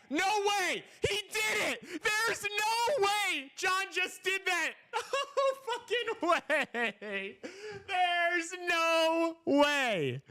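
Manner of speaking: screaming high in disbelief, laughing; frat bro. Real, not acted. Bet hits, screaming in disbelief.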